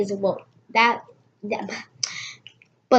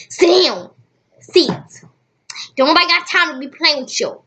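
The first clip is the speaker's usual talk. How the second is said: loud, high, fast bossy snap; sassy loud scolding; 7 semitones higher, 7 dB louder than usual. Real, not acted. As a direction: order her to sit, fed up. Scolding sister mid-reading.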